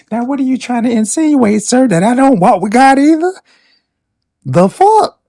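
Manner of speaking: high, loud indignant challenge; outraged, going way up in pitch. Real, not acted. Outraged at [name]'s remark.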